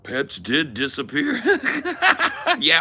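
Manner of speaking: talking through laughter; real laugh breaking the line. Real, not acted. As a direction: chuckle the words out. Laughing at own bit.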